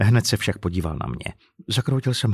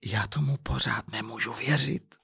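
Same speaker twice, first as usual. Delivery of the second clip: hushed, much softer disbelief; soft, barely voiced murmur; whispered; 9 dB quieter than usual. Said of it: "zašeptala jeho manželka".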